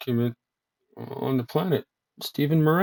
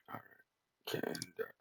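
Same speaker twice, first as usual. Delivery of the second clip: whispered to self; 16 dB quieter, slower than usual. Real, not acted. Muttering at computer.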